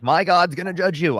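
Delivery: high, loud mock of a believer; mocking preachy voice. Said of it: "you can't just be like".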